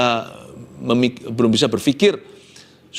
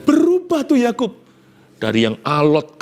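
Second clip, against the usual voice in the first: ordinary talk, nothing marked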